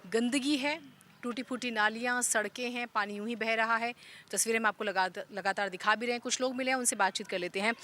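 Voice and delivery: steady reporter's on-the-spot intro; news presenter. Acted. Reporter introducing the village report to viewers, news read. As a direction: present the village's problems to camera like a field reporter.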